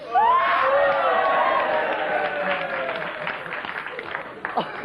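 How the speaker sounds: long high shrieking laugh; falsetto laugh. Acted. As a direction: let out a shrill falsetto hoot. Falsetto laugh after question.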